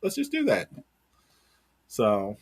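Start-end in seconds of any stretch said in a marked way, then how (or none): 0.0-0.7 high-pitched mock enthusiasm; sarcastic, higher-pitched quoted logic; mock booker pitch. Mocking bookers' reasoning for the pairing.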